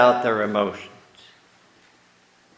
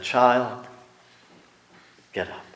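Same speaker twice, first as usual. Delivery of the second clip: put-on soft, tender "Child, get up"; hushed and kind; gentle; 4 dB quieter than usual. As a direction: wake the girl tenderly. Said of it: "Jesus gently took her by the hand".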